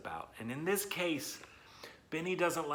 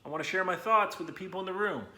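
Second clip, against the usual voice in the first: high, loud, eager "yo, thumbs up"; excited kid voice; 6 semitones higher, 7 dB louder than usual. Pretend. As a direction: play the keen student asking to share. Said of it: "you're gonna say".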